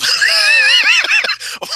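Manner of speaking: big loud burst of laughter. Real, not acted. Laughing at his own New York voice, a loud burst.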